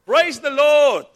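slow, very loud, high cry of praise; shouted praise. Acted. Old lady on her porch praising God, slow loud shout. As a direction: shout it to heaven in a put-on voice.

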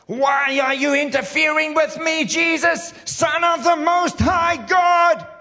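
shrieking high demon scream, drawn out; hammed-up possessed man screaming at Jesus. Preacher acting out the demon shrieking at Jesus, loud and high. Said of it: "Let's act it out".

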